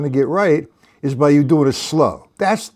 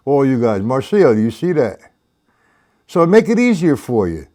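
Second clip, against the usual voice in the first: normal talking voice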